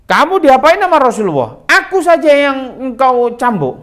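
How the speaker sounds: high, loud, outraged demand; indignant protest. Retold or imitated. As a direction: defend the Prophet with a loud, high cry. Abu Bakar protests the whipping. (Abu Bakar memprotes dengan marah dan keras)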